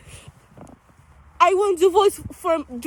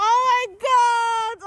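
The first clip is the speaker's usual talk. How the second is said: a drawn-out, pitch-raised oh my God; mock amazement; 6 semitones higher, much slower than usual. Told his accent sounds Romanian, slow playful delight. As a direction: savour the fake thrill, stretching every word.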